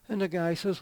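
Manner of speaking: ordinary talk, nothing marked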